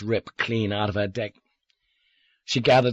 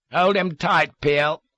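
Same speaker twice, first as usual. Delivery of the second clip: higher, louder command; sharp, louder command; commanding order; 8 semitones higher, 5 dB louder, slower than usual. A narrator's reading. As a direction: order the henchman firmly. Villain orders henchman.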